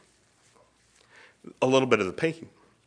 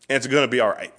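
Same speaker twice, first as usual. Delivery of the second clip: ordinary delivery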